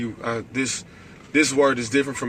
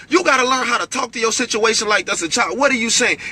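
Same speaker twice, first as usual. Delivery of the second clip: rising, fervent preacher's delivery; preaching; 9 semitones higher than usual. Acted. Preacher exhorting his live viewers, fervent preaching. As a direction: preach it up to the congregation.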